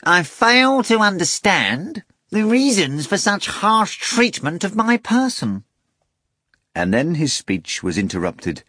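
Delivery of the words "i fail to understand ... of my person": high, slow offended cat; pompous cat voice. A narrator's reading. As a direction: play the indignant talking cat. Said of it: "the cat commenced".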